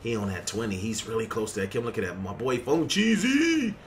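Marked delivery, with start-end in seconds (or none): 2.2-3.7 rising, drawn-out call of a teammate's name; hype shout-out. Real, not acted. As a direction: stretch the name up high.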